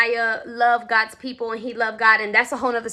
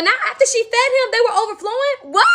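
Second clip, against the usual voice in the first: high-pitched, astonished questioning; amazed disbelief; 9 semitones higher than usual. Real, not acted. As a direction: marvel at it, pitch jumping up high. Narrator amazed at the miracle.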